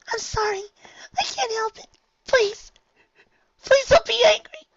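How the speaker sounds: very high, rising frightened plea; pleading whimper. Acted. Fluttershy begging friends, very high tearful plea. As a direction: beg through tears. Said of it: "Fluttershy whimpered".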